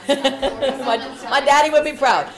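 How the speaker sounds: laughing, loud and high. Real, not acted. Preacher laughing through the joke about his daddy, loud.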